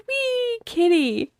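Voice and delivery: high, soft mock little boy. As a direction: coo at the kitty like a child. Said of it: "this little boy runs up to the cat and says".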